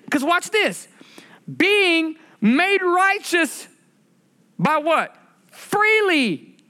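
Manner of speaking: high, stretched sermon delivery; preaching at full force. Acted. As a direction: lift it up like a pulpit climax. Peak of sermon, high pitch.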